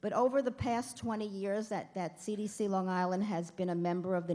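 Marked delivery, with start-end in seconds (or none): none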